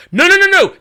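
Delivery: high, loud string of noes; excited correction. Real, not acted. Host finding proof it was Macho Man, excited correction. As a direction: cut in urgently with rapid denial.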